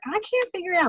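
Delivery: high, mildly exasperated imitation; mock helpless customer. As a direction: voice a stumped customer, pitched well up. Voicing a confused customer's text.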